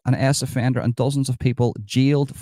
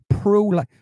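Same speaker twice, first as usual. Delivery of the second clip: high scandalised repeat; mock disbelief; 9 semitones higher than usual. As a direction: throw the word back, scandalised. Outraged at a pro-life commenter.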